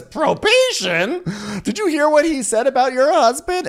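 very high, outraged disbelief; hammed-up squeal of disbelief; mock incredulous. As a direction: echo the news in exaggerated shock. Acted incredulous reply to probation news.

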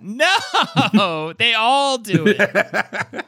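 high, drawn-out comic wail through laughter; mock anguished protest. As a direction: wail it in playful despair. Mock protest at spelling threat.

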